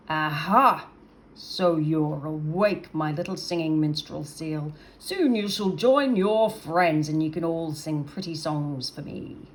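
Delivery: a big menacing creature savouring his catch; gloating monster. Acted. Creature taunts the captured seal, slow and gloating.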